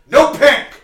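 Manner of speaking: loud, high snap of "No pink!"; mock customer yelling "No pink!"; sudden shout. Customer shouting abruptly, retold.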